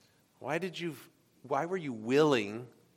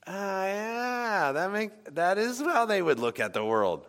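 dawning realization; 6 semitones higher than usual. Retold or imitated. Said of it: "I'm like".

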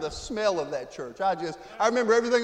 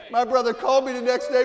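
ordinary talk

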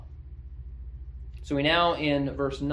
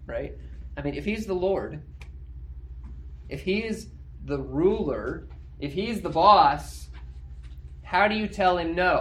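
ordinary delivery

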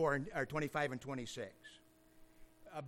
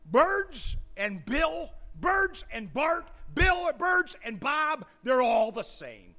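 high, loud, slow listing; mock mystic proclamation; 9 semitones higher, 8 dB louder, slower than usual. Preacher answering as the pantheist, mock mystic proclamation. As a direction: proclaim each name slowly in a raised voice.

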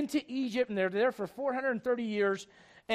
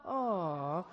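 soft, drawn-out cooing aw; hushed sentimental aw; mock swoon; 3 semitones lower, 5 dB quieter than usual. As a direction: coo in pretend sympathy. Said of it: "and you go".

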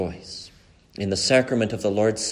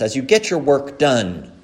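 usual speaking voice

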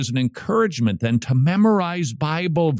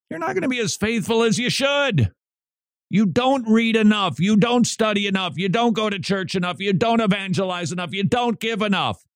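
impassioned preaching; 10 semitones higher than usual. Real, not acted. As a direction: hammer each failing home, pitched up. Preacher builds to list of failings.

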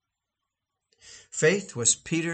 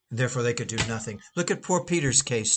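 normal talking voice

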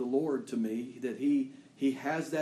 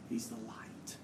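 whispered; 2 semitones lower, 8 dB quieter than usual. Real, not acted. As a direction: breathe it like a secret. Hushed climax of sermon.

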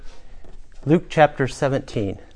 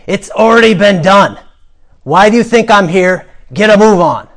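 loud, higher, impatient guard; gruff guard; 7 semitones higher, 8 dB louder than usual. Acted. Guard hurries the lingering prisoner, gruff and loud. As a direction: order the prisoner along roughly. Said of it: "The guard mutters".

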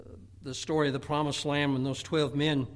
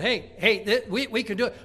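eager urging; 5 semitones higher, 6 dB louder than usual. Acted. Caleb and Joshua urging.

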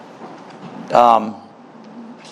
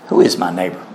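sarcastic question; 8 semitones lower, faster than usual. Retold or imitated. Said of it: "You can almost hear the sarcasm".

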